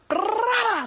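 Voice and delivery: high, rolling "brrr-ah" smack sound; explosive trilled sound effect; rolled slap noise. Retold or imitated. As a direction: imitate a rapid-fire slap. Imitates the slap mid-wave.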